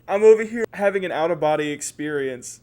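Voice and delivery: ordinary talk, nothing marked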